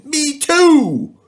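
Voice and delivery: high, loud yelp; the tiger shouts along. A narrator's reading. Tiger joining the lion's complaint, loud high shout. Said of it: "shouted the tiger".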